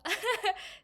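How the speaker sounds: sudden loud high laugh; burst of laughter. Real, not acted. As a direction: laugh out at yourself. Laughs at herself mid-story.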